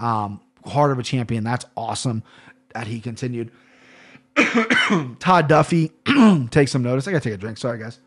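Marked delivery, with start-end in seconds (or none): 4.3-5.1 hard, high-pitched clearing of the throat; real throat clearing. Real, not acted. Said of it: "Goddamn frog in my throat". 6.0-6.4 short, loud throat clear; sharp interrupting throat clear; real throat clearing. Real, not acted. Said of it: "Goddamn frog in my throat".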